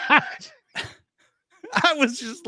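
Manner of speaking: laughing. Real, not acted. Laughs recalling meeting her.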